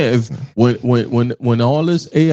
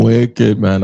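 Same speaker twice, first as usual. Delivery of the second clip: put-on Jamaican accent; 6 semitones lower than usual. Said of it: "saying that with my Jamaican accent".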